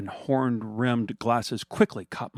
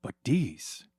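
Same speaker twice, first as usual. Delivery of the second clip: hammed-up Italian accent; 5 semitones higher than usual. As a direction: play a cartoonish Italian photographer. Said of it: "stereotypically and probably offensive accent".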